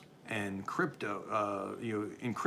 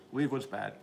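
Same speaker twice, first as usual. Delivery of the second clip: ordinary talk, nothing marked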